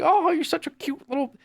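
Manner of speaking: put-on high cooing baby talk; mock doting adult. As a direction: coo down at a small child.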